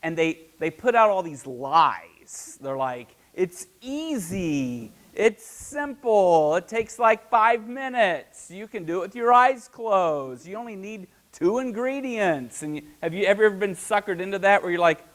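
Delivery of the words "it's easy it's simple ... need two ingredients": higher, slow, salesy ad pitch; hyped recipe video. Retold or imitated. Cooking video host hyping easy recipe to viewers, salesy. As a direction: sell it like a cooking clip promising ease.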